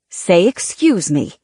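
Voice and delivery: slow, prim correction; an etiquette maven's deliberate reminder; instructing a child. A narrator's reading. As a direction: instruct the girl slowly in manners. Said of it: "Missus Windsor instructed the girl".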